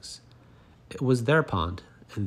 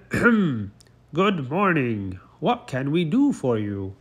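slow, louder, pompous greeting; formal chief frog; 4 semitones higher, 4 dB louder, slower than usual. Acted. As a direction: chief frog politely addressing the visiting pig, slow and louder. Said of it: "the chief frog spoke up".

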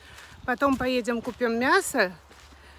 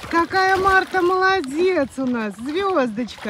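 gushing praise; 5 semitones higher, 3 dB louder than usual. Real, not acted. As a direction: coo over a good dog. Gushing over the dog after swimming.

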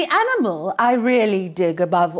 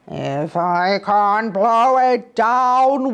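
wolf; 3 semitones higher, 5 dB louder, much slower than usual. A narrator's reading. Wolf speaks.

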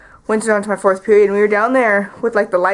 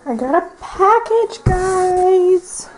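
high-pitched, drawn-out show-off voice; playful excited announcement; 10 semitones higher, much slower than usual. Acted. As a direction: announce it to viewers in a high, stretched-out voice. Showing off a package to viewers.

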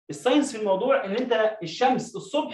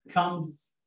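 "The sun comes up in the morning" with long pauses between words; word by word dictation; 2 semitones lower, much slower than usual. Shown as a demonstration. Teacher dictating the sentence again to pupils, word by word. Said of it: "وقول لي the sun comes up".

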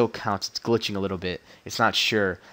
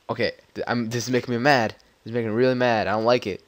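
usual speaking voice

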